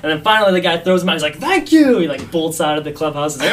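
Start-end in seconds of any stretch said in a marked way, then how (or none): 1.4-2.0 high, loud burst of relief; mock elated thanks. Batter finally thrown out by the umpire, in mock jubilation. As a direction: exclaim it high and loud, delighted to be released. Batter gleeful at being ejected.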